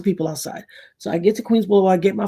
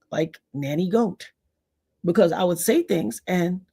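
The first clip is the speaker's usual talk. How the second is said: ordinary delivery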